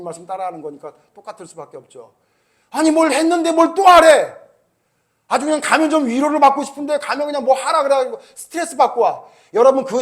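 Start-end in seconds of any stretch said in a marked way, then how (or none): 2.7-7.3 higher, louder mock grumble; indignant complaint. Preacher voices the congregation's complaint, a higher, louder mock grumble. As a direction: whine that more is being asked.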